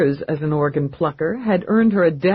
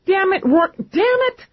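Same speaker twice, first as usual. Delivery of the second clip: furious outburst. Acted. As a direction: explode at him in fury. Eve explodes at Rourke.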